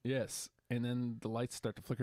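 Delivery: ordinary delivery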